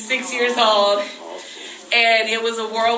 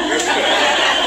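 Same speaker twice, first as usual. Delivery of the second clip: giggling laugh; 5 semitones higher than usual. Real, not acted. Laughing at the baby throne.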